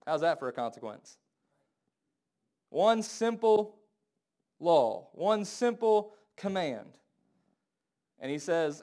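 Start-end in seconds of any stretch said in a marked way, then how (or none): 2.7-7.0 slow, spaced out, each word stressed; slow emphasis. Real, not acted. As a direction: drive each word home slowly. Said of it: "slow emphatic phrase".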